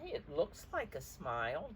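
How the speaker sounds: plain reading voice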